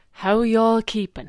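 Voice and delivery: high, loud country greeting; mock Kilkenny greeting. Shown as a demonstration. Host greeting listeners in a Kilkenny lilt. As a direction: call out the homely Kilkenny way. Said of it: "As we say here in Kilkenny".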